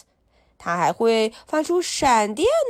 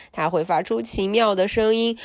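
plain reading voice

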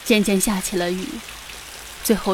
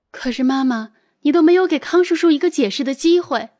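put-on higher, pleading young woman; pleading daughter voice; 6 semitones higher than usual. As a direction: plead with her mother.

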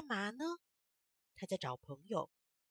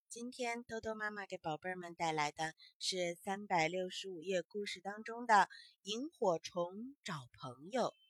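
ordinary delivery

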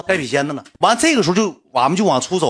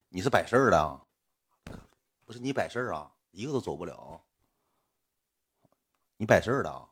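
low, soft imitation of a tough guy; understated threat, dropped in pitch and volume; quiet menace; 5 semitones lower, 9 dB quieter than usual. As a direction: challenge them without raising your voice. Retells a bystander's threat.

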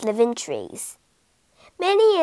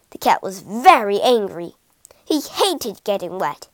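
ordinary delivery, nothing marked

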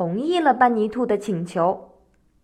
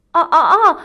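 crafty, louder, higher chuckle; sly laugh; 4 semitones higher, 6 dB louder than usual. A narrator's reading. Rabbit dodging the fox's question, sly laugh. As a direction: laugh slyly to cover up a trick. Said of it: "狡猾地笑着回答".

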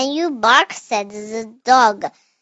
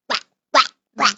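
frog croak; much faster than usual. Acted. Child makes the frog's croak.

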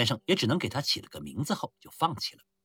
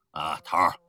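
muffled booming voice. Acted. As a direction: speak thick and hollow from the chest. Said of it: "瓮声瓮气地开口说道". (瓮声瓮气的低沉嗓音)

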